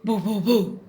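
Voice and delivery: loud imitated barks; dog bark. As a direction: bark out three quick woofs. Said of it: "the bark of a dog".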